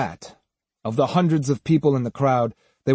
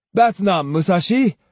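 higher-pitched scornful protest; scornful, raised-voice contradiction; disdainful cry; 7 semitones higher, 4 dB louder than usual. A narrator's reading. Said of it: "Jotaro cried disdainfully".

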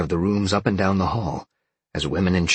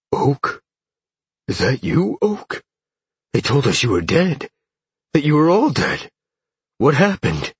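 higher-pitched stunned disbelief; astonished; 8 semitones higher, 5 dB louder than usual. A narrator's reading. Man stunned his friend is alive, astonished. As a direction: blurt out shocked questions.

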